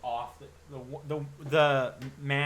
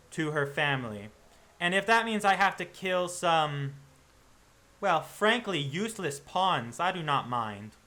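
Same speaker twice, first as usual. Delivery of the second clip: higher, louder, deliberate menace; cold, polite mercenary; 4 semitones higher, 5 dB louder than usual. Acted. Saber warns the werewolf hunters, coldly polite. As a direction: threaten with perfect courtesy.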